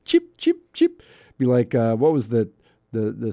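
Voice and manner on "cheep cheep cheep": bird chirp. Pretend.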